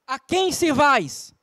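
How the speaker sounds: very slow, weighty question; slow solemn question. Acted. Joshua challenging the people, slow and solemn. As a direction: let each word hang.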